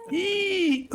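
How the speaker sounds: very high, sustained "eee"; bad bug squeal. Game master mocks own off-the-cuff sound effect, high squeal. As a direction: redo the awful bug squeal, high and held. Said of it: "my off-the-cuff sound effects".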